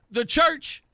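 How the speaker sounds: ordinary delivery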